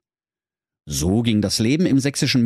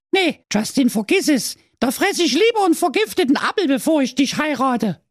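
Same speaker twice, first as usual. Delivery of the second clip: very high, fast brush-off; a princess shooing off a clingy dwarf. Acted. (sehr hoch, schnell und abweisend)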